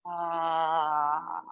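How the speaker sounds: soft drawn-out exhale sigh; dramatic sigh. Shown as a demonstration. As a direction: sigh it all out. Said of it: "sigh it out".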